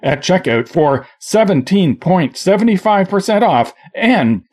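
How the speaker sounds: upbeat radio ad voice; ad read. Acted. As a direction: sell it like a commercial. Mock radio ad.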